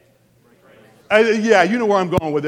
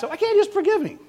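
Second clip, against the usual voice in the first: high-pitched mock questioner; imitated questioner; 13 semitones higher, faster than usual. Preacher imitates people asking about forgiveness, high mock plea. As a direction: plead it in a much higher voice. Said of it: "people ask".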